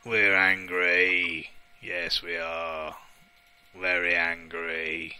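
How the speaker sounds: a hammed-up sulky chorus; mock grumbling crowd. Gamer answers his own poll as a slow mock-grumpy crowd.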